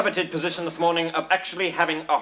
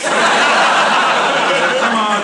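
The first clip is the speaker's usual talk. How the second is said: loud, higher, long drawn-out coaxing; urging call; 4 semitones higher, 6 dB louder than usual. Acted. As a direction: call out to egg on the bidders. Auctioneer urging the bidders.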